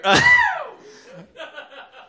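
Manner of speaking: high shout of laughter; loud high laugh. Real, not acted. Host laughs at his own boast.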